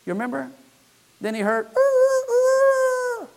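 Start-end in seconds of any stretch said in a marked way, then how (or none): 1.7-3.3 high falsetto rooster crowing. Retold or imitated. Preacher imitates the rooster crowing after Peter's denial, high falsetto crow.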